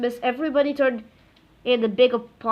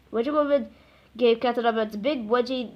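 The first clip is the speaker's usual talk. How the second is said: plain reading voice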